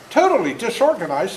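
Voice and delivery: usual speaking voice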